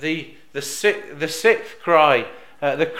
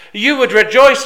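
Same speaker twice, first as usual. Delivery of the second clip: louder and higher, rejoicing; joyful proclamation; 9 semitones higher, 10 dB louder than usual. Acted. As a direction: preach the promise with rising joy. Jesus quoted to disciples.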